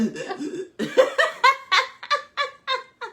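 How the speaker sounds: squealing laugh; high laughter. Real, not acted. Laughing at the story, high squeal.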